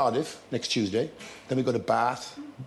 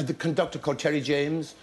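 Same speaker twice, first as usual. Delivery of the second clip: ordinary delivery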